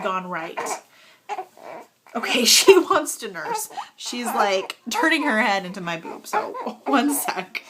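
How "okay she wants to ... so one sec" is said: laughing. Real, not acted. Baby wants to nurse.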